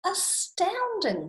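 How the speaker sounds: high, drawn-out wonder; slow, lifted amazement; astounded Gruffalo. Acted. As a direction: stretch the word out in awe. Gruffalo impressed by mouse.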